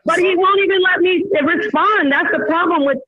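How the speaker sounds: shouting over the moderator in protest; upset shout. Real, not acted. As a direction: raise your voice, cut in hard. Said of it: "don't get emotional".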